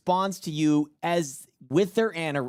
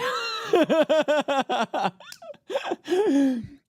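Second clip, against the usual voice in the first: loud laugh; 14 semitones higher, 4 dB louder than usual. Real, not acted. Laughs at own joke.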